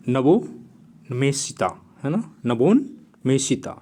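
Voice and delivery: drawn-out, syllable by syllable; slow careful pronunciation. Shown as a demonstration. Teacher giving students the Hindi word नवोन्मेषिता, said slowly and carefully. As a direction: ease each syllable of नवोन्मेषिता out slowly for the class.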